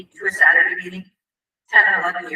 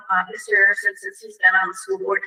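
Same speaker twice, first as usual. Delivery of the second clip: ordinary talk, nothing marked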